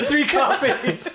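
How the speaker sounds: slow laughing repeat. Real, not acted. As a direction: drag it out laughing.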